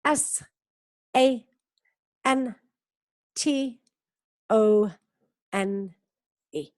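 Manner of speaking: S, A, N, T, O, N, E one by one with pauses; teacher spelling her surname for students; spelling out letters. Shown as a demonstration. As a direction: spell each letter clearly. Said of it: "my last name is [name]".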